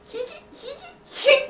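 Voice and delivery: high strained effort grunts; straining grunts. Retold or imitated. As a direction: fourth grader straining to pry open the window, high grunting. Fourth grader straining at window.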